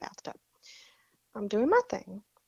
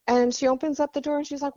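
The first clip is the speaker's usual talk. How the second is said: normal talking voice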